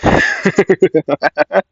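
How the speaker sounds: a high, amused villain laugh; high character laugh. Acted. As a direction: laugh up high, pleased with yourself. Character laughing after a taunt.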